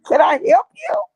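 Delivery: loud, high, pointed question; sharp and suspicious. Retold or imitated. Answering the stranger who called back, loud, high and suspicious. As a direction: cut him off with a pointed challenge.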